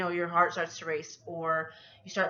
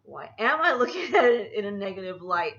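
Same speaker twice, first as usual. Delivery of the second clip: laughing; 5 dB louder than usual. Real, not acted. Laughing at her own negativity.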